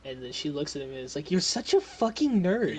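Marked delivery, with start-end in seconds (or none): 1.3-2.8 higher, louder teasing put-down; mocking jab. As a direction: scoff at a pedant, pitched up. Character mocks a pedantic correction.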